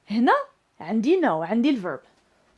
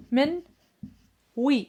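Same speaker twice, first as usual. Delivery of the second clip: normal talking voice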